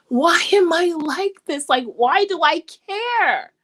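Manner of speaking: mildly acted plea, dragging the words out; exasperated younger self. Young speaker questioning their own sensitivity, lightly acted exasperation. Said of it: "there were times when I was young".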